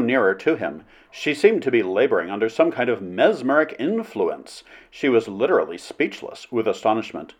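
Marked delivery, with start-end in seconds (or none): none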